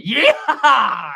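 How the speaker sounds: loud, high American cowboy whoop; full cowboy holler. Acted. Greeting Jimmy as American [name], full cowboy yell. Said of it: "American [name] went full cowboy".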